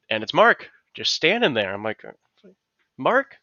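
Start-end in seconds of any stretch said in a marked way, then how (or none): 3.0-3.4 a high call across the street. Retold or imitated. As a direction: call his name from a block away. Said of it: "I yell to him".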